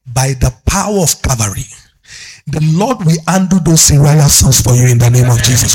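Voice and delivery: loud, declarative prayer over the congregation; building prayer declaration; forceful preaching prayer. Real, not acted.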